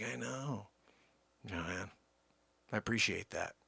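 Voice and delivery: low, soft, understated reply; quiet reply. Retold or imitated. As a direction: murmur a modest thanks, low. Answering compliment, quiet aside.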